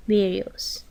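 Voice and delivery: ordinary delivery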